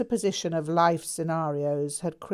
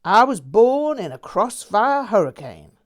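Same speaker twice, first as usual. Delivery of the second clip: louder, higher grand declamation; theatrical; 5 semitones higher, 10 dB louder than usual. A narrator's reading. Jack making a grand entrance to his tale, theatrically. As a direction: proclaim it like a rock legend. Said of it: "Then theatrically".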